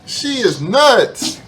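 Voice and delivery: loud, slow, high "she is nuts"; drawn-out shocked verdict; incredulous exclaim. Real, not acted. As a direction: stretch it out in disbelief. Reacting to woman ranting on camera.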